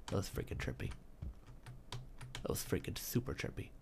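hushed awed whisper. Real, not acted. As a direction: breathe it out in awe. Awed after trippy moment.

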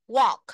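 "Walk" said loud with a round open O and the l silent; pronunciation model. Shown as a demonstration. Teacher modelling "Walk" loudly for the class. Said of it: "Âm O".

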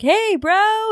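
high, bright mock greeting; cheerful sister. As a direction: toss it off like a breezy sister. Sister greeting voiced in story.

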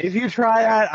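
laughing. Real, not acted. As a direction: laugh through the threat. Threat restarted through laughter.

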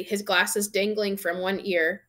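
plain reading voice

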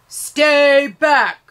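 loud, high barked command; shout. A narrator's reading. Policeman holding back the mother, loud shout. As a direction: bark it at the crowd. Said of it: "Then he shouts".

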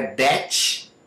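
"that" said as "detch"; D for TH and "tch" closing "that"; learner's wrong "that", ending in CH; mispronounced D. Shown as a demonstration. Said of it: "Não é".

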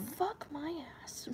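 hissed whisper of frustration; frustrated whisper. Real, not acted. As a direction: whisper it in annoyance.

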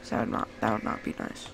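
soft unvoiced whisper, begging; whispered plea. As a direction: whisper it, almost secretly pleading. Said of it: "whispered plea".

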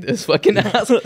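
half-laughing at the joke driver; laughing insult. Retold or imitated. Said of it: "But that shit made me laugh".

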